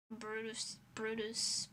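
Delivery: normal talking voice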